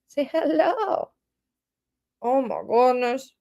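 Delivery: sing-song pet voice for the cat. Real, not acted. Coaxing cat, baby talk.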